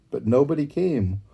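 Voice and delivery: ordinary delivery, nothing marked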